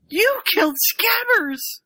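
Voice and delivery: high wail of accusation; high accusing shout. Retold or imitated. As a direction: cry it out high. Ron accuses Harry.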